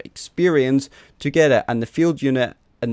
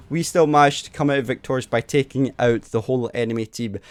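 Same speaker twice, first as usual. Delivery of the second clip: ordinary talk, nothing marked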